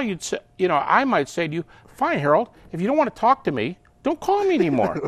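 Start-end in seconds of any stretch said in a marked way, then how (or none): 1.9-5.1 higher-pitched mock indignation; hurt and fed up. Spurned friend tells Harold off, indignant and higher-pitched. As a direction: act out an exasperated telling-off. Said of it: "you get a little emotional".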